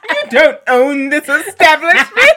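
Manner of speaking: loud, laughing haughty lady; Karen voice. Pretend. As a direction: play the offended customer. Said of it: "full Caucasian woman".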